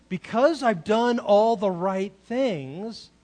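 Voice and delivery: loud, slow, emphatic claim; imitating a son insisting on what he is owed; entitled older son. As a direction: lean hard on each word. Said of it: "because he thinks".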